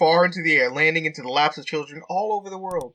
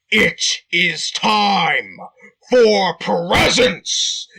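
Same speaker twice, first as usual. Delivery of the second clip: very slow, loud, every word hammered; each word emphasized; 2 semitones higher, 6 dB louder, much slower than usual. Acted. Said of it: "emphasized each word".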